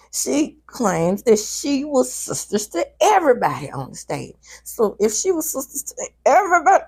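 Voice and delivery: annoyed, talking through teeth. Real, not acted. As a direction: grit your teeth and talk. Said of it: "I'm talking through my teeth".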